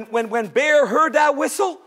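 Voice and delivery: usual speaking voice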